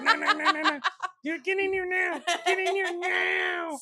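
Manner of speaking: very high, shrieked mock order; panicky wife impression. Ordering him indoors from the wasps, frantic mock shriek. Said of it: "She's all like".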